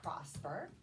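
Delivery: ordinary talk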